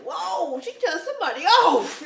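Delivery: loud, high-pitched mock astonishment; amused shock. Customer overhearing nail techs argue, amused loud shock. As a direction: react big, as if witnessing a telling-off.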